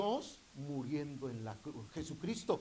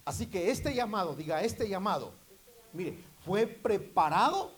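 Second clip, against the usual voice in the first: loud, high, fervent preaching; preaching at full force; 3 semitones higher, 7 dB louder than usual. Acted. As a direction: build it up and drive it home to the congregation. (predicación a todo pulmón, fervorosa y fuerte)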